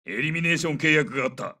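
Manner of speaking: ordinary talk, nothing marked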